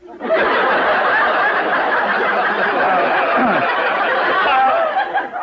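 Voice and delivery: long breathy chuckle at his own joke; hearty laugh. Acted. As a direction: laugh it off, amused at the ad. Husband laughs at the ad.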